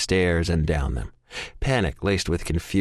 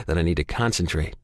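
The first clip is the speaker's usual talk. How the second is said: ordinary delivery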